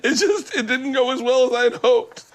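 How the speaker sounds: high, breaking mock sob; mock crying whine. Comedian retells his rejection in a mock sob. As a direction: whimper it like a hurt kid.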